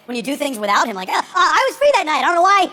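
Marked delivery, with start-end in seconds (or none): 1.1-2.7 hammed-up whiny complaint from a jilted friend; whiny male friend. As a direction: imitate an aggrieved guy who felt left out. Mocking whiny angry male friend.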